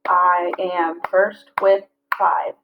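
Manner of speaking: slow, each syllable spaced out; slow syllable-counting read. Shown as a demonstration. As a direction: count the beats as you say it. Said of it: "So if we think about it".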